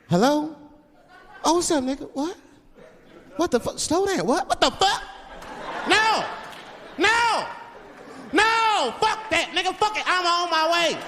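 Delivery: very high, heated outbursts into a phone; high-pitched acted fury, building to yelled refusals; agitated phone call. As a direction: mimic a driver flaring up on a call. Driver's alarmed, angry phone call.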